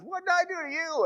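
high, fast, pleading donkey voice; hurt donkey asking what it did wrong; talking donkey. Acted. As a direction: squeak it out like a wounded donkey. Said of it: "it looks back and says".

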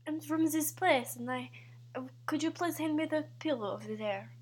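put-on soft, tentative Swedish accent. Player testing partner's guess, soft attempt at Swedish accent.

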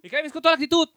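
mock boss shout. Boss voice mocking applicant.

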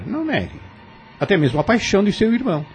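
sly insinuating jab; mocking taunt. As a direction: tease her with what he knows. Lázaro taunts Maria with secrets.

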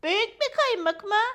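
high, loud, rising question; curious dog friend. Acted. Fülfül asks Maya about the splinter, high curious voice. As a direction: ask eagerly in a high dog voice.

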